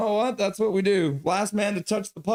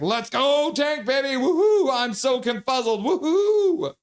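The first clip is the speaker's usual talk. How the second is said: much higher, louder squealing cheer; hyped fan; 9 semitones higher, 4 dB louder than usual. Retold or imitated. Streamer voices a chatter's cheer as a high whooping squeal. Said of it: "Donnie Bluebone's like".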